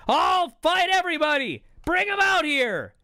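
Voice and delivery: very high, shouted mock wrestler promo; Jarrett still ranting. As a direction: holler threats like a raving wrestler. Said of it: "Now Jarrett's still ranting".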